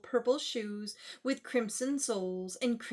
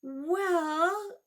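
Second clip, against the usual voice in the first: ordinary delivery, nothing marked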